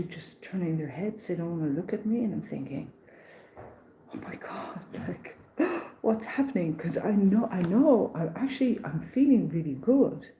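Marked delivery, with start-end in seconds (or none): 4.1-6.8 hushed gasp of alarm, then higher; mock-horrified breathy reaction; shocked onlooker. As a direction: imitate someone aghast, whispered then gasping.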